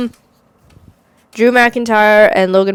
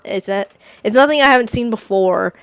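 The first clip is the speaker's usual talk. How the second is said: ordinary talk, nothing marked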